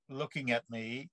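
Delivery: ordinary delivery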